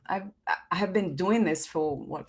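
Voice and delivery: ordinary talk, nothing marked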